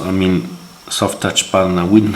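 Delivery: ordinary delivery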